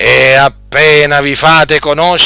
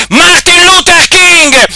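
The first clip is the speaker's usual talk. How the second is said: scornful pulpit cry; 4 dB louder, slower than usual. Acted. Preacher scorning a name.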